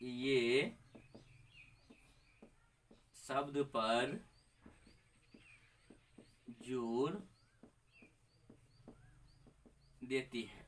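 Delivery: slow classroom dictation, one word at a time. Shown as a demonstration. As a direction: dictate slowly so students can write it down. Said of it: "यहाँ लिख देते हैं ये".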